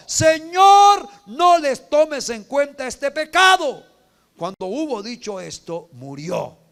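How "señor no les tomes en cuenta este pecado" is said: loud, high, slow plea; anguished cry. Acted. Dying Stephen begging forgiveness for his killers, loud and high. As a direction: cry for mercy while being stoned. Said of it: "cayó de rodillas y gritó".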